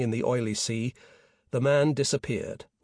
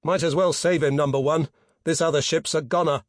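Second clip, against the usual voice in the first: raised, higher officer's call; yell; 4 semitones higher, 5 dB louder than usual. A narrator's reading. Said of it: "Peter yelled".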